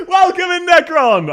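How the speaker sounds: very high, loud greeting; called-out welcome. Real, not acted. Streamer welcoming a viewer by name, high loud call-out. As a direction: sing out a high, excited hello.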